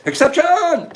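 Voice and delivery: loud, high shout of the name; excited call. Real, not acted. Announcing a viewer as winner, excited. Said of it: "calling a winner out loud".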